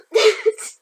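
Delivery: sudden loud real laugh; a loud burst of helpless laughter. Real, not acted. Author breaks into laughter.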